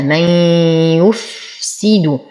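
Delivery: slow من يفسد, hummed y and lingering fa; idgham with ghunna. Shown as a demonstration. Teacher reciting for students, من يفسد with nasal merging. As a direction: merge من into يفسد and hold the fa. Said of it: "فهو إدغام يحتاج إلى غنة".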